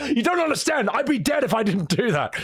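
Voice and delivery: high, laughing through the words; can't keep a straight face. Retold or imitated. Streamer laughing while voicing the old master, laughing. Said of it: "laughing through the quote".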